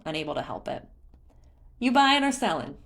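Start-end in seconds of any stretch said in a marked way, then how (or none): 1.8-2.8 higher, louder sales pitch; eager merchant. A narrator's reading. Beetle asking Link about trade in a raised merchant voice. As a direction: hook a customer.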